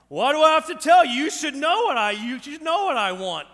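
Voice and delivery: loud, high-pitched angry outburst; imitating a fed-up wife yelling at her husband; exasperated wife. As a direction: snap back at full volume, indignant. Wife's exasperated reply, acted.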